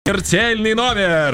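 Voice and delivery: high, slow ringmaster announcing; booming circus ringmaster. Acted.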